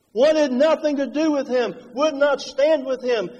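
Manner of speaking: usual speaking voice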